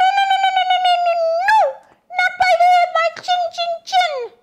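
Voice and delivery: very high squeaky piglet voice, fast; little pig. A narrator's reading. Pig's squeaky reply.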